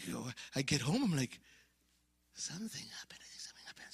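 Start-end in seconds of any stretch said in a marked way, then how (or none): 2.3-3.8 hushed, breathless whisper; soft unvoiced whisper of wonder; awed whisper. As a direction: whisper it like a secret discovery. Whispering his secret thought at home.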